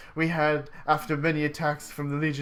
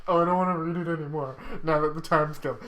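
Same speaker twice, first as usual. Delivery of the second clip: ordinary delivery, nothing marked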